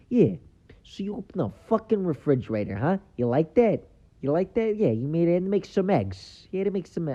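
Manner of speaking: high, pushy mock-wiseguy narration; Joe Pesci. Comedian as Pesci narrating your life at the refrigerator, pushy impersonation. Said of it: "You want Joe Pesci to narrate your life".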